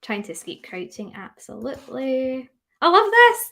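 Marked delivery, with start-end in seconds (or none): 2.8-3.5 high, loud I love this; delighted. Real, not acted. Pleased with the class's answers, high loud delight. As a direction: burst of real delight.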